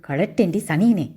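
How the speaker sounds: ordinary delivery, nothing marked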